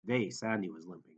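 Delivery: usual speaking voice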